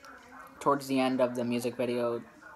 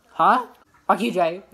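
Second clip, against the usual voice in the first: loud mock yell at Jay; angry shout; 7 semitones higher, 13 dB louder than usual. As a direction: snap at him, loud and high.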